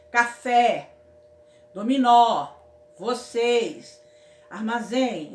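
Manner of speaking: "Café", "dominó", "vocês", "armazém" ditas bem alto, marcando a última sílaba; ênfase na tônica. Shown as a demonstration. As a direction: grite cada palavra batendo na sílaba final. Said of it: "botamos o acento na última sílaba". (exemplos de oxítonas bem fortes)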